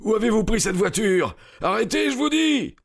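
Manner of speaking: very high, strained yell. A narrator's reading. Cyclist demanding the stolen cart be stopped, yelling. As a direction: yell a furious demand at strangers. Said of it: "hurla-t-il".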